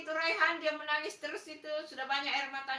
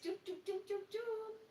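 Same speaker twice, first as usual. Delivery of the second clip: put-on soft, higher hushing like to a baby; mock hushing of a crying child; soothing hush; 6 semitones higher, 8 dB quieter than usual. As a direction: coo him quiet, softly. Hushing a pretend crying boy.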